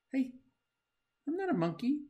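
high indignant denial; protest, offended and high; mock protest. Reader denying being a monkey, mock indignant.

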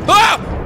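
sudden high loud yelp; a short shocked shriek; startled scream. Real, not acted. Gamer jump-scared by a villager, startled scream.

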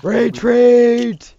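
loud, high, drawn-out yell; shouted battle order. Real, not acted. Commander ordering troops to retreat, long loud shout. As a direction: bellow it across a battlefield.

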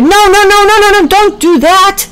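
high, loud pleading no's; Packy yelling to stop little Geoffrey; frantic shout. Acted. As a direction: shout to stop him before it's too late. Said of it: "Packy shouted".

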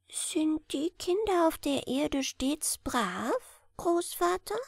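high, soft, slow timid little hare; embarrassed question. Acted. Little hare asking shyly about the children, high and soft. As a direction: ask shyly. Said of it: "ein wenig verlegen".

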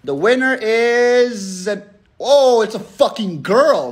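slow, drawn-out reveal; suspense announcement. Real, not acted. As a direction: stretch it out to build suspense. Announcing raffle winner with suspense.

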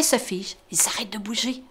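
hushed narrator whisper; suspense whisper. A narrator's reading. Narrator to child as rabbits freeze, whispering. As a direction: freeze the moment.

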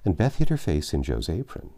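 usual speaking voice

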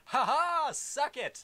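high, loud gleeful taunt; gloating taunt. Real, not acted. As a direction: crow over the win with a laugh. Gloating after blinding enemies.

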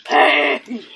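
loud, high yelp of pain; mock pain cry. Playful cries when hurt in game.

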